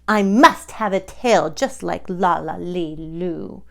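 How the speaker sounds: put-on lively read-aloud to children; storybook reading. A narrator's reading. Host reads Doctor Seuss aloud to children. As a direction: read it like a picture book.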